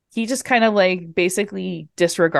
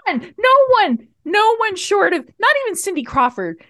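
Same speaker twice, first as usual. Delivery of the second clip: incredulous outburst; 10 semitones higher than usual. Real, not acted. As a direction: blurt it in disbelief. Incredulous outburst about the mask.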